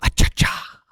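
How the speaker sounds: loud, breathy "A cha-cha"; Ace Ventura impression. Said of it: "it's like Ace Ventura".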